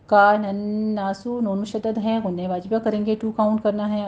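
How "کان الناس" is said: کان الناس with the نون held for two counts of ghunna; correct tajweed. Shown as a demonstration. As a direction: say کان الناس slowly with a long nasal نون. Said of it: "کانا، کا جیسے با،".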